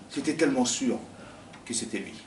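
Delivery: ordinary delivery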